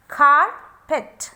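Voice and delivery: "carpet" broken as "car... pet"; split into syllables. Shown as a demonstration. Teacher showing two syllables are there, "carpet" split as "car... pet". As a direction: say "carpet" loudly with a gap after "car". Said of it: "pronounce it properly".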